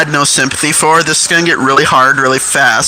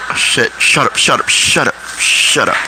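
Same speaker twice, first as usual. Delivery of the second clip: urgent hushing; 2 semitones lower than usual. Retold or imitated. Laughers caught out, hushing each other under the breath.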